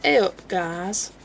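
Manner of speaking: slow, drawn-out Yorkshire greeting; attempted Yorkshire accent. Shown as a demonstration. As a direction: have your best go at Yorkshire, stretching the words. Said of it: "that's the best I can do, a Yorkshire accent".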